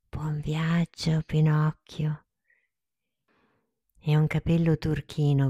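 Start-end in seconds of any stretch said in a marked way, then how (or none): 0.1-2.3 slow, tender whispered farewell; fairy's whisper. Acted. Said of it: "La fata gli sussurrò".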